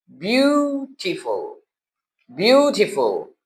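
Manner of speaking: ब्यूटीफुल with "byoo", the e silent; teaching pronunciation. Shown as a demonstration. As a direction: read ब्यूटीफुल straight as b-u, skipping e and a. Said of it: "B यू directly पढ़ेंगे".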